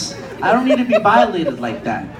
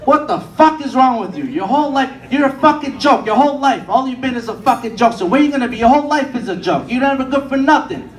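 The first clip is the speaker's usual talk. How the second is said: higher, harsh berating; father's rant; 7 semitones higher than usual. Retold or imitated. Father berating his son after a failed joke, angry rant. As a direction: tear into him like a fed-up dad. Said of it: "my father just looks at me like".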